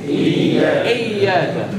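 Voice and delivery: اياك drawn out very slowly, doubled ya held; stretched demo. Shown as a demonstration. Teacher drawing out the word for students. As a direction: hold the doubled ya in اياك long. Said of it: "تقول ايه؟".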